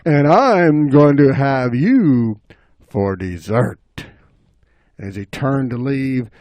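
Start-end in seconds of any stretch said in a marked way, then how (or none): 0.0-4.1 slow, relishing threat; menacing bobcat. Acted. Bobcat threatening the chipmunk, slow menace. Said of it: "and he said".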